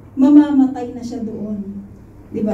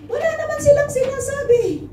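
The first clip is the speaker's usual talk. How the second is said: much higher, mocking sing-song; mock indignation; 11 semitones higher than usual. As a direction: toss the line up high in mock disbelief, leaning on "silang". Mocking what drinkers are told.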